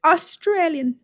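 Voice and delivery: accidental hint of an Irish accent; almost Irish accent. Real, not acted. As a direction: slip slightly into an Irish accent. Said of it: "came out in almost an Irish accent".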